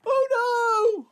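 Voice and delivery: high, loud hammed-up cry of alarm; regular panic. Co-host showing how anybody else would panic, exaggerated alarm. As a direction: wail it in mock panic. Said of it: "if that was anybody else, it'd be".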